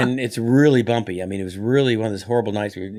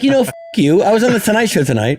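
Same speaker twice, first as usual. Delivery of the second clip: loud high mock offense; hammed-up indignant retort; 11 semitones higher, 7 dB louder than usual. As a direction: fire back in fake outrage. Retorting to Jay.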